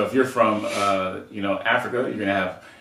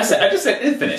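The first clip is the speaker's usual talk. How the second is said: exasperated insisting; 5 dB louder than usual. Real, not acted. Insisting in a podcast argument.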